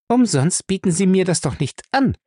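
high, emphatic challenge; firm. A narrator's reading. Faye challenges Bellamy's money offer, firm. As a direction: stand your ground, pointedly. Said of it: "sagte Faye fest".

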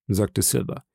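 usual speaking voice

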